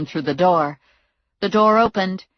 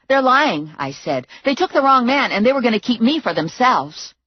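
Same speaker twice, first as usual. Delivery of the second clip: higher, pressing denial; urgent denial; 6 semitones higher than usual. A narrator's reading. Heroine accusing her captors, high and urgent. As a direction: talk fast to save yourself.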